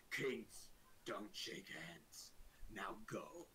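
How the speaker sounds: very slow, low royal voice, falling to a near-whisper; dismissive orc king. Acted. Orc king refusing a handshake, slow and dismissive. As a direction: pronounce it slowly, then dismiss them under your breath.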